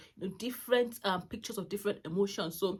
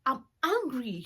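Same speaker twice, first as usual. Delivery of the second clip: son's angry reply; 5 semitones higher, 4 dB louder than usual. Retold or imitated. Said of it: "It's like".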